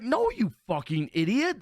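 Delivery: high-pitched mock scolding; retold exasperation. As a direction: act out setting a foolish friend straight. Retold exasperated answer to friend.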